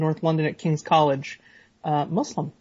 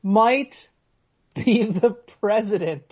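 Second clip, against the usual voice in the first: raised and drawn out, word by word; slow, pointed emphasis; 3 semitones higher, much slower than usual. Real, not acted. Co-host pressing the point about Trump, slow pointed emphasis. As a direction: spell it out slowly for a listener who isn't getting it.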